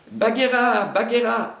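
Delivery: loud, high call into the jungle. Acted. Baloo calls the panther through the jungle, loud call. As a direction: call her name across the trees. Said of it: "appelait-il".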